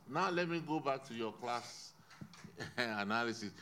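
ordinary talk, nothing marked

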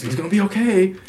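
put-on soothing girlfriend. Girlfriend voiced calming him down.

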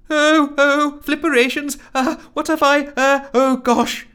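high, loud incoherent panic; flustered squealing stammer; garbled fluster. Acted. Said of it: "garbled Rusty incoherently".

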